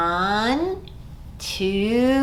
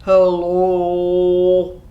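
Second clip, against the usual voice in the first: deep, lower, louder hello; 5 semitones lower, 5 dB louder than usual. Shown as a demonstration. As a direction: drop it to the bottom of your voice. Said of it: "Let's say hello as low as we can".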